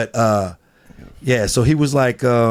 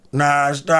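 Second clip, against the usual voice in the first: yelling a name; 4 semitones higher, slower than usual. Retold or imitated. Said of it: "yelling my name like this".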